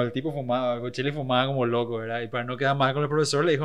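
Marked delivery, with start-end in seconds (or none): none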